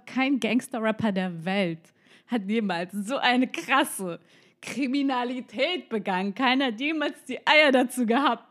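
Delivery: hammed-up dramatic boast; mock-epic proclamation. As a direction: proclaim it like an outrageous feat, stretching each word. Hypes own offence to friend.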